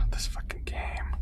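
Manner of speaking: hushed, slow, fed-up whisper; whispered frustration. Real, not acted. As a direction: whisper it under your breath, exasperated.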